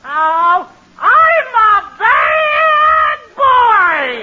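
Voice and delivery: high, hugely drawn-out bawl; hammed-up childish wail; wailing bad boy. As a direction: howl it like a small boy caught out, stretching every word. Boy wails when shamed.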